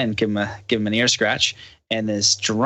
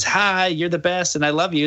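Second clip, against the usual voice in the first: sweet praise; 7 semitones higher than usual. Retold or imitated. Mocking someone praising you, sugary. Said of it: "when someone says".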